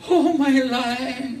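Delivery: high, slow and laughing through it; mock doting. A teacher mocking love for a lion, high slow laughing coo. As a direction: coo it like a fool in love with a wild beast. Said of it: "and went".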